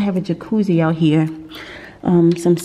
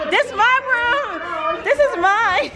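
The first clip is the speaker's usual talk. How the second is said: high, drawn-out squeal through laughter; playful possessive cry; slower than usual. Pretend. Vlogger jokingly staking claim to her room, playful squeal. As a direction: claim it gleefully, laughing hard.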